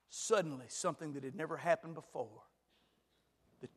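ordinary talk

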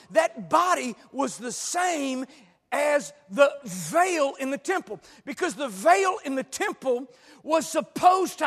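high, loud, full-force sermon shouting; impassioned pulpit shout, key words stretched; fiery preaching. Real, not acted. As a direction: hammer it home to the congregation.

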